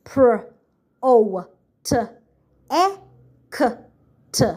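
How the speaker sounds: protect sounded out as Pr, O, T, E, K, T; sounding out. Shown as a demonstration.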